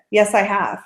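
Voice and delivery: usual speaking voice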